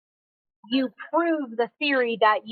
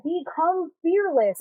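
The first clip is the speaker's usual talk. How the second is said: slow, higher-pitched imitation of advice-givers; quoted slogan; 6 semitones higher, slower than usual. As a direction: draw it out as other people's lofty advice. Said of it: "a lot of people will be like".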